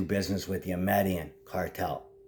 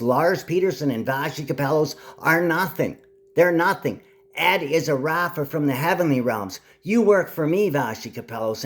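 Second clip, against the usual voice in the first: higher and louder, commanding and scolding; shouting rant; 6 semitones higher, 4 dB louder than usual. Real, not acted. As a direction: thunder at them as God. Proclaiming himself God, scolding people.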